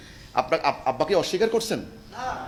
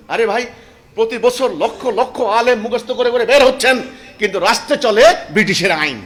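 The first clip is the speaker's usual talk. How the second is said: much higher and louder, fervent preaching; impassioned preaching; 5 semitones higher, 9 dB louder than usual. Acted. Preacher recounting the Prophet's sacrifices, fervent loud preaching. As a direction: cry it out with holy fervour.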